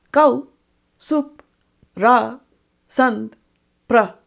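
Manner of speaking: Kau, Sup, Ra, Sant, Pra each sung alone with pauses; isolated chanted syllables Kau Sup Ra Sant Pra; stress points. Shown as a demonstration. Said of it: "These are the main emphasis points".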